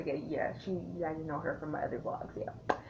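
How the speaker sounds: normal talking voice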